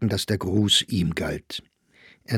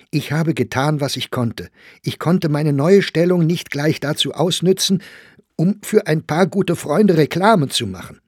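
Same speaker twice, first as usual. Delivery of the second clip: higher, heated self-defence; getting angry; 7 semitones higher, 5 dB louder than usual. A narrator's reading. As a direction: defend yourself with growing anger. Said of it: "Meyer wurde nun auch zornig". (zunehmend zornige Rechtfertigung)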